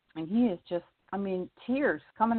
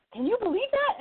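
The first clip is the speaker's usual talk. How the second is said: high, eager question; excited. Retold or imitated. Woman telling her teacher the story, excited. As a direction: pitch up with excitement.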